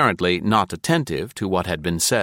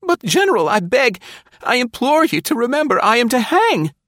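very high, loud, frantic begging; desperate pleading; 5 dB louder than usual. A narrator's reading. Condemned spy begging the General, frantic pleading. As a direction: plead for your life, voice pushed up high.

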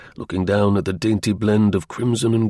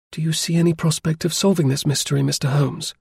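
put-on higher-pitched young lady with mild edge; touch of asperity; 6 semitones higher than usual. A narrator's reading. Young lady questioning Holmes about solving the mystery, touch of asperity. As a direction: press him, slightly sharply. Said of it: "with a touch of asperity in her voice".

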